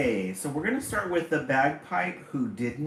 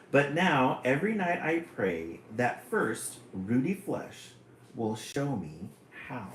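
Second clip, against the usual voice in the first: poem reading; 2 semitones higher, 6 dB louder than usual. Acted. Streamer reading a funny poem to chat, hammed-up and lively. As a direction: relish the rude poem like a storyteller. Reads second poem aloud.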